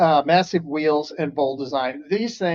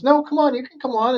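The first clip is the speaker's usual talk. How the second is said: high coaxing voice; 8 semitones higher than usual. Retold or imitated. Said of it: "And then Kelly goes".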